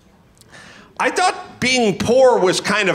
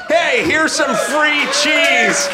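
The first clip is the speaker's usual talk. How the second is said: slow, higher sneering mock offer; mocking handout voice; 7 semitones higher, slower than usual. Host mocks government handouts, sneering slow. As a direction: dangle it like a sarcastic gift.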